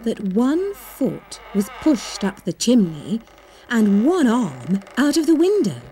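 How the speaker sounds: ordinary delivery, nothing marked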